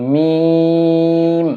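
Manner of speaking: মিম with a long, level vowel; drawn-out letter name. Shown as a demonstration. Teacher introducing the Arabic letter to learners, মিম drawn out long. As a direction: hold the middle of মিম on one steady tone. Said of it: "এই হরফটির নাম হলো".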